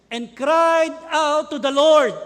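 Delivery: loud, slow anguished cry. Acted. Preacher acting the terrified Israelites, loud slow cry. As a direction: wail it out to the Lord, drawn out.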